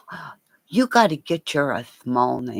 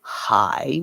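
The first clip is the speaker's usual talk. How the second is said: usual speaking voice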